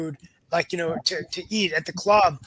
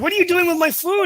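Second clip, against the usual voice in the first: much higher, squeaky outrage over her lunch; girl protesting coach taking her food, much higher; indignant kid protest; 12 semitones higher, 4 dB louder than usual. Retold or imitated. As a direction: play the wronged teen, voice jumping up. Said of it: "I would be like".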